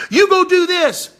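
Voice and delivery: shrill mock Jezebel ordering her husband; bossy wife. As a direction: order him around like a nagging wife. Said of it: "married to a Jezebel".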